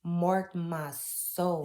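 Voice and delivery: normal talking voice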